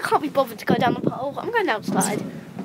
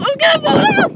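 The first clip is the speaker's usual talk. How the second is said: high, loud, laughing-scared cry; real fear of falling; panicked plea; 6 dB louder than usual. Real, not acted. Begs to stop, scared laughing.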